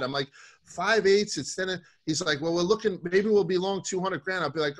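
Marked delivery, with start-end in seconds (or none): 0.6-1.4 higher, louder price shout; called-out price. Retold or imitated. Trader shouts the price to Tommy K, higher and louder. 2.4-4.4 excited trader estimate. Retold or imitated. Tommy K estimating position.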